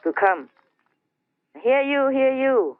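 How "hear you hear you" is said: higher-pitched town-crier call; storyteller voicing a herald's cry. Leaders calling everybody to come.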